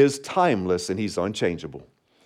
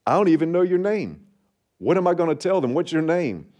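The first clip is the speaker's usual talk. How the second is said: pleading Moses; 7 semitones higher, faster than usual. Retold or imitated. Moses questions God, retold.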